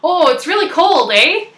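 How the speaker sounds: loud, high Canadian small talk; playfully Canadian. Shown as a demonstration. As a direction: ham up the Canadian "eh". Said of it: "feel a little more Canadian".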